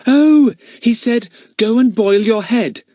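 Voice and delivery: put-on high, slow explaining girl; lightly voiced sensible girl; Daisy voice. Daisy explains Ern's phrase to the others, high and slow.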